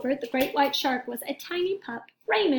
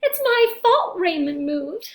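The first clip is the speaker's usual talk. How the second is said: loud, slow, guilty snap at himself; snapping; 6 semitones higher, 7 dB louder, slower than usual. A narrator's reading. Young shark snaps at passing eel, loud and slow. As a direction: bite the words out in frustration. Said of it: "Gilbert snapped".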